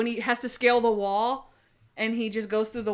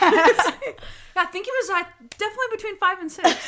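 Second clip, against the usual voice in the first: mock his claim with a smirk; ribbing her cohost for fibbing; teasing mock; 9 semitones higher, 5 dB louder than usual. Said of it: "So you're pulling a Monk".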